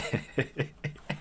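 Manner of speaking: wheezing, breathy laugh; stifled laughter. Real, not acted. Laughing at awful comic dialogue, wheezing. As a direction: lose it in a quiet wheeze. Laughs at the line just read.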